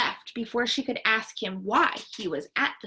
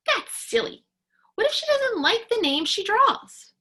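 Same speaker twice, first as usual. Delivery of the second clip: put-on louder, much higher child's protest; objecting; 11 semitones higher, 5 dB louder than usual. A narrator's reading. Ralph frowns at Rosie's idea, objecting. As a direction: push back on a silly idea.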